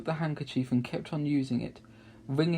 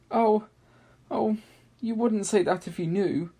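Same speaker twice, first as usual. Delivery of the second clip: high, loud words between sobs; sobbing; 9 semitones higher, 6 dB louder than usual. A narrator's reading. Mister Tumnus sobbing to Lucy. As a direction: speak through the sobs. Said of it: "between his sobs".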